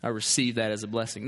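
low, understated mock testimony. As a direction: say it as a deadpan pretend testimony. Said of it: "it's weird for us to talk that way".

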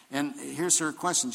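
normal talking voice

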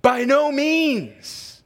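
"By no means" drawn out slowly, NO pitched high; emphatic denial. Real, not acted. Pastor reading Paul's answer, emphatic "By no means". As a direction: lean long and high on "no" in "By no means". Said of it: "It can't be more emphatic than that".

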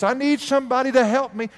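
high call for help across the shop; retold yell. Clerk shouting to the back for help, high and urgent. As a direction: clerk calling out for a helper, high-pitched. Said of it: "she yells out".

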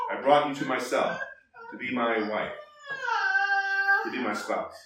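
soft, tender and low, almost breaking; tender husband's vow. Shown as a demonstration. Teacher voicing God's promise to a bride, tender and hushed. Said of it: "Think of a marriage".

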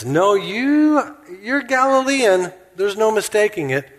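high-pitched accuser, well above own voice; high mock accusation; acted accusation. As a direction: call someone out in a high, insistent voice. Accuser pointing Peter out, acted.

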